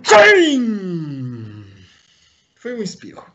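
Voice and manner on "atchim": loud sudden real sneeze. Real, not acted. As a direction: let out a sharp sneeze. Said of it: "vou espirrar um momento".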